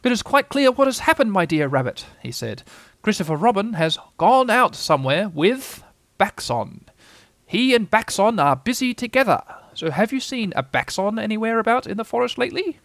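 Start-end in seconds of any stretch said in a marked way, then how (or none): 0.0-2.0 high, louder owl voice; knowing Owl. Acted. Owl explains the notice to Rabbit, high and knowing. 3.0-12.8 high, deliberate owl voice; pompous Owl. Acted. Owl tells Rabbit what has happened, high and pompous.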